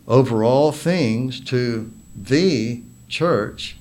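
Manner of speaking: slow down and hammer each "the" as "thee"; stress on THE. Shown as a demonstration. Preacher showing how the Bible uses the word, stress on "the". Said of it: "Did you notice how the Bible uses the word "the".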